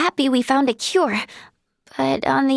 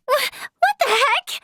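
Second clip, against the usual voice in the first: stammered, high-pitched disbelief; shocked outburst; 10 semitones higher than usual. Acted. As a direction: splutter it in astonishment. Startled by something unexpected.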